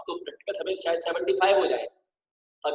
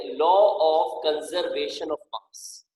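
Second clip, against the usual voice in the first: slow dictation; much slower than usual. Shown as a demonstration. Teacher dictating the law's name.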